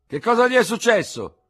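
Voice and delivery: high, loud call from a window above; surprised old doctor shouting from the window; old army surgeon calling down. Acted. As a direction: call down to the street, puzzled. Surgeon asks.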